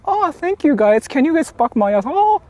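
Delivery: high mock voice. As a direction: squeal it out in falsetto. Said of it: "he'll go like".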